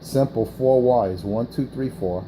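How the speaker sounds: ordinary talk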